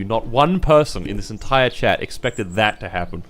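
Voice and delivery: ordinary talk, nothing marked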